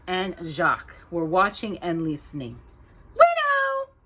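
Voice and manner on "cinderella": very high squeaky mouse cry; excited mouse squeak. Acted. Mouse Gus bursting with news, high excited squeak. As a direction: squeak it out like a thrilled little mouse. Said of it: "Gus squeaked excitingly".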